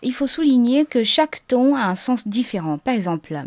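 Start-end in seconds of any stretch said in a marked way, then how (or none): none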